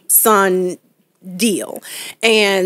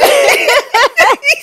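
squealing laughter, louder; loud high laugh; 6 dB louder than usual. Real, not acted. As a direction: break into a big laugh. Laughing after the story.

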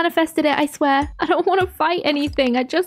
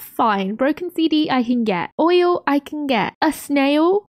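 ordinary delivery

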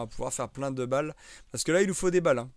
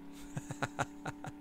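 soft laugh; 10 dB quieter than usual. Real, not acted. Laughing at chat's teasing.

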